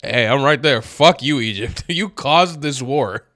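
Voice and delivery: laughing mock shout at Egypt; mock shouted reply. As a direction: yell back at the commenters, half laughing. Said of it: "And I'm like".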